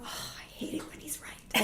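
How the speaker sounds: a sheepish whispered grumble; grudging whisper to herself. Retold or imitated. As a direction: breathe it out as a grudging private admission. Inner thought, admitting husband right.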